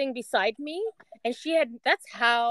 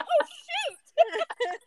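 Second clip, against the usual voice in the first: high, laughing mock alarm; startled oh shoot. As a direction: yelp it as the tears spill out. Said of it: "and I was like".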